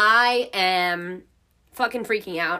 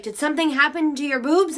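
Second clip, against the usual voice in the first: confused mom; 7 semitones higher, 4 dB louder than usual. Retold or imitated. Mom's confused question.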